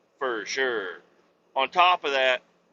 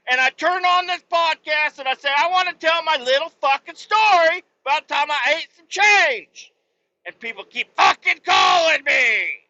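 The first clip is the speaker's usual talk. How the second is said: shouted, much higher and louder, genuinely pissed off; real furious rant; 11 semitones higher, 6 dB louder than usual. Real, not acted. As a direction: yell it out, truly fed up with the calls. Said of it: "I'm so pissed".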